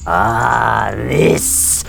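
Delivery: slow, low hissing snake voice; drawn-out s like a snake. Acted. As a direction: stretch every s like a serpent. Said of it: "I don't know why I sound like a snake".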